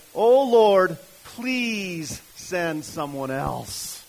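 loud, drawn-out plea with a very slow 'please'; hammed-up imploring; pleading. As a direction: beg God off, stretching it out. Reads Moses begging God off.

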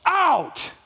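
high ringing shout of a name; Jesus commanding the dead man; loud cry. A narrator's reading. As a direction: call the dead man out with full voice. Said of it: "in a loud voice, he cries out".

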